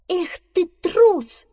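high, loud, drawn-out scolding; angry. Acted. Squirrel to badger feigning illness, angry. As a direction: scold the coward, high and stretched out. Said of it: "рассердилась белка".

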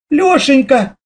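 high, tender cry of the name; mother hugging her son; relieved exclamation. A narrator's reading. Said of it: "воскликнула мама". (радостный возглас матери)

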